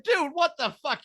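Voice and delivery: high, shocked disbelief; incredulous. Retold or imitated. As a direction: react in open disbelief. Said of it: "and I'm like".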